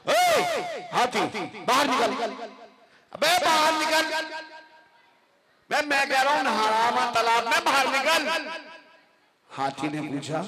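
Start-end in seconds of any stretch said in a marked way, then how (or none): 0.1-8.5 angry mouse. Acted. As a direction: bark him out of the pond. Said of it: "बहुत ही गुस्से में हाथी से बोला". (ऊँची, ज़ोरदार गुस्से वाली चूहे की आवाज़)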